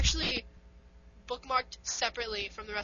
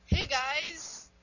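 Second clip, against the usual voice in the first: loud, higher-pitched show greeting; podcast intro; 5 semitones higher, 6 dB louder than usual. Shown as a demonstration. Co-host showing how to intro, big upbeat greeting. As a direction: hail the listeners loudly. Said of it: "this is how we should intro".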